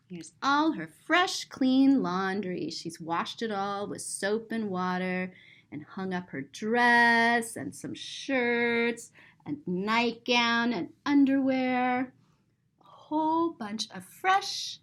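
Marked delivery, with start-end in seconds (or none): none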